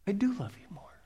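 gentle whispered reassurance; very soft, hushed whisper; little inner voice. Acted. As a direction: whisper it like a voice inside the heart. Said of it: "this little voice says".

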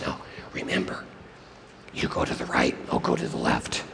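very soft, low conspirator's whisper; hiss-free, secretive whisper to your partner; hushed robber in ambush; whispered orders. Acted. Robber instructing partner while hiding.

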